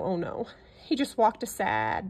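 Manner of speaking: plain reading voice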